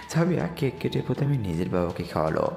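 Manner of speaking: ordinary delivery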